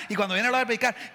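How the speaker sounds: ordinary talk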